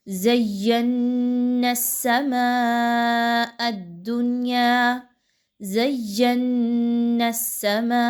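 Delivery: زَيَّنَّا with doubled ي and ن held firmly, slow; strong shadda. Shown as a demonstration. Teacher demonstrates firm shadda joining to students. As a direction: press the shaddas of زَيَّنَّا hard. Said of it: "کیسے".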